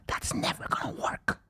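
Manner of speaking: very soft whispered warning; imitated friend whispering bad news; retold hushed warning.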